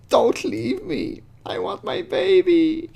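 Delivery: put-on high, slow, drawn-out pleading girl voice; mock pleading girl. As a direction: stretch it out like a clingy teenager begging. Reading Kayla's clingy chat message.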